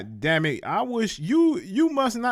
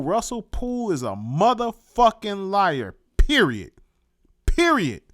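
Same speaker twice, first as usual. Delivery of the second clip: loud, punched "Period", each one its own sentence; "motherfucking" drawn out, then "Period" punched loud; imitated emphasis; 5 dB louder than usual. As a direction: mimic his emphatic verdict, hammering "Period". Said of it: "He put emphasis on that shit".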